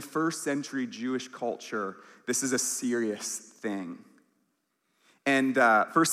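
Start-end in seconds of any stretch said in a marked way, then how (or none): none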